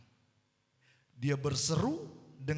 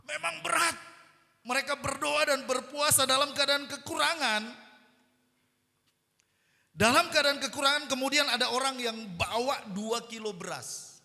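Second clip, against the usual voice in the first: raised, loud preacher's delivery; impassioned preaching; 10 semitones higher, 7 dB louder than usual. Real, not acted. Preacher telling how hungry believers received rice, impassioned. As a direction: preach it high and forceful.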